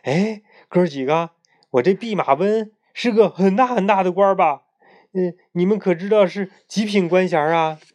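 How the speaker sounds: a swaggering monkey fishing for praise; 得意洋洋; happy Monkey King. A narrator's reading. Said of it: "孙悟空高兴地问道".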